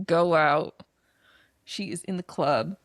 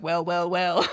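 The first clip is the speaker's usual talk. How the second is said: low sly villain voice; low, sly menace; menacing villain; 3 semitones lower, slower than usual. Retold or imitated. Retold villain greeting in movie.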